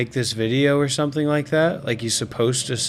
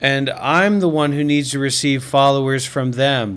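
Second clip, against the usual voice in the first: usual speaking voice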